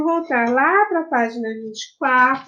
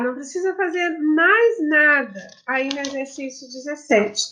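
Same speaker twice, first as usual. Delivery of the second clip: ordinary talk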